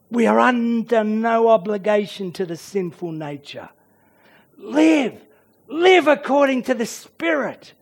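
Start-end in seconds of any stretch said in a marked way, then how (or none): none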